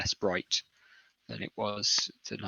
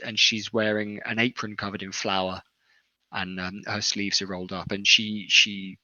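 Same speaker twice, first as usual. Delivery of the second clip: ordinary talk, nothing marked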